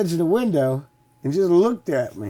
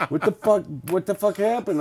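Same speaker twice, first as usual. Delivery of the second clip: mock shocked boss; 7 semitones higher than usual. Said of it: "he goes".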